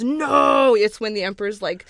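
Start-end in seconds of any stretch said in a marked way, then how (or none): 0.0-0.7 drawn-out mock movie yell, higher and louder; hammed-up dramatic cry; retold yell. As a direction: imitate a film villain yelling out. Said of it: "he yells".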